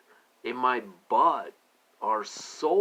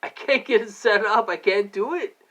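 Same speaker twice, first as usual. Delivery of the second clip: high, exasperated retelling of giving up; frustrated outburst; 9 semitones higher, 4 dB louder than usual. Retold or imitated. Retelling his outburst at the campsite, high and exasperated. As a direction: throw up your hands and quit. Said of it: "I'm like".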